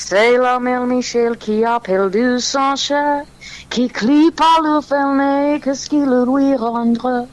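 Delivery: slow, sing-song recited French; speaking French. Shown as a demonstration. Guest performs French for eager hosts, slow French recital. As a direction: show off your French, drawn out line by line. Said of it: "I'll speak French".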